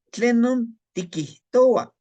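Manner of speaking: "Tlenon tikihtoa" slow, high and loud, carefully sounded; slow Nahuatl demo. Shown as a demonstration. Teacher modeling "Tlenon tikihtoa" loudly for the class. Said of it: "teaching Nahuatl phrase".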